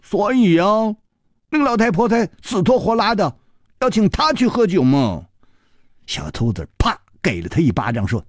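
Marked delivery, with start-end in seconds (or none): 0.0-5.3 high-pitched pouty whine; pouting boy. A narrator's reading. Boy concluding why the old woman insisted, high pout. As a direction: talk through a pout. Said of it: "听到这儿撅了嘴说".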